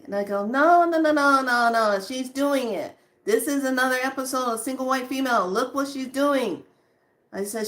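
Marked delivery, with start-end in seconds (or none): 0.5-6.6 retold alarmed insistence, pitch raised; insistent protest. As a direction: cut in urgently to warn a friend. Retold urgent warning to friend.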